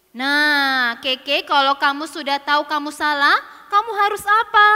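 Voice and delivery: loud, instructive motherly scolding; firm mother. Acted. Mama asking Keke what to do, firm and loud. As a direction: prompt a child to own up.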